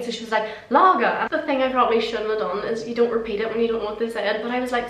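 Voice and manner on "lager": higher, louder imitated annoyed English woman; really annoyed. Annoyed customer repeating her order to the waitress, imitated exasperation. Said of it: "she get really annoyed".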